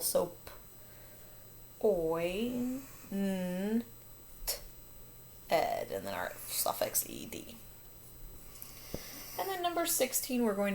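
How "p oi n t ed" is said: "p-, oi, n-, t-, ed" one sound at a time. Shown as a demonstration. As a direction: separate "p-, oi, n-, t-, ed" with clear pauses. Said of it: "we hear that oi in the middle".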